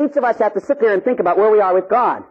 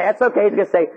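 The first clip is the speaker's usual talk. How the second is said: usual speaking voice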